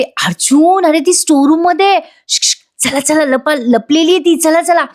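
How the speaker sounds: high, excited call with a shush; urgent news. A narrator's reading. Sister rushing in to say she found her, acted excitement. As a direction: pitch it up and hurry everyone along.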